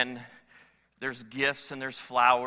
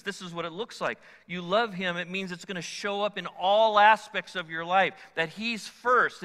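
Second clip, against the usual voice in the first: ordinary delivery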